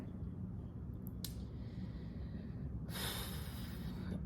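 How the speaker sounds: slow exhausted exhale; long weary sigh. Real, not acted. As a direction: sigh it all out, drained. Long sigh before the story.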